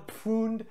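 Pfund with the P sounded before the f; P pronounced. Shown as a demonstration. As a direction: say Pfund opening on a German pf. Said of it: "P's are pronounced in German".

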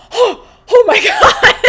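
high, loud, laughing disbelief; stunned outcry breaking into laughter; shocked gasp. Real, not acted. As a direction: gasp it out, then crack up. Shocked at the near miss.

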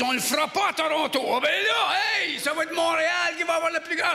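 high, hammed-up eagerness; excited mayor. Montreal's mayor hears Toronto won't get it, high and excited. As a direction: jump on it with mounting glee. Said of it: "Le maire Drapeau est excité".

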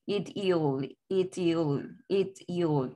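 "It, you'll" split clearly into IT-ul; two falling beats on "It, you'll"; dos sílabas. Shown as a demonstration. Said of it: "dos sílabas". ("It, you'll" separado en dos sílabas)

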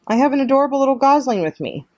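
high, quick and cheery; friendly robot. A narrator's reading. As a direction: chirp it out to a stranger across the pond. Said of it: "the robot's friendly voice boomed".

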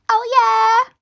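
high, gleeful oh yeah; victory cheer. Acted. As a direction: celebrate like a dancing kid. Victory dance around the house.

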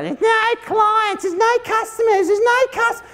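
high, slow whining complaint; whiny voice. Retold or imitated. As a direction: whine it like a moaning shop owner. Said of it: "this whiny fucking voice".

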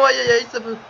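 ordinary delivery

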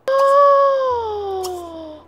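sharp high gasp of shock; big gasp. Real, not acted. Player startled by sudden teleport, loud high gasp.

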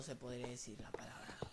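aparte en susurro rápido. Real, not acted. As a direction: lo dice en secreto. (susurrando al margen)

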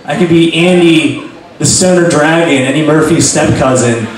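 ordinary delivery

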